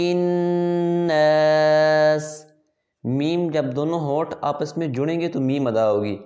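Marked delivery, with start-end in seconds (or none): 0.0-2.5 slow drawn-out tajweed chant; Quran recitation. Acted. Teacher reciting for pupils to repeat, slow chant. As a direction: recite each syllable long and even, like in the mosque.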